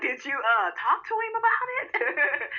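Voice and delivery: high, slow, prissy Kimmy voice; mock Kimmy. Vlogger mimics Kimmy asking Maurice about it, high and slow. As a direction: put on a high, precious Kimmy question. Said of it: "Kimmy was like".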